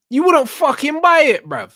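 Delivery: excited outburst. Real, not acted. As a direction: yell the punchline, worked up. Ranting about advert burgers.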